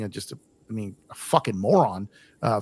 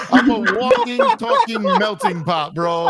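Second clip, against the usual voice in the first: higher and slower through laughter; laughing; 10 semitones higher, 5 dB louder, slower than usual. Real, not acted. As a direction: say it while cracking up at yourself. Laughing at his own mixed roots.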